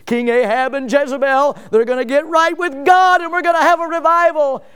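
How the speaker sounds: rising, building to loud high excitement; lightly voiced prophet growing thrilled; hopeful Elijah. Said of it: "he's thinking".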